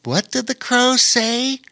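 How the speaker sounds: high-pitched character voice, questioning; puzzled Rowan. A narrator's reading. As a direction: ask it in a high little voice. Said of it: "Rowan was about to say".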